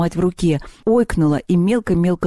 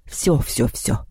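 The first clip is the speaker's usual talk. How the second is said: low, softer, hasty surrender; placating eagles; 5 semitones lower, 3 dB quieter, much slower than usual. A narrator's reading. Eagles backing away from the girl, placating. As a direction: give in quickly, keeping it low.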